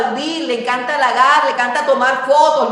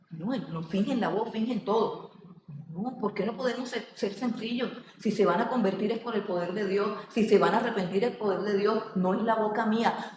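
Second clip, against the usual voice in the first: very soft, low, plain plea; hushed sincerity; 3 semitones lower, 10 dB quieter than usual. Real, not acted. Preacher pleading with church for simplicity, hushed and sincere. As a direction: drop right down to a quiet, unforced voice. Drops voice after rejecting shouted preaching.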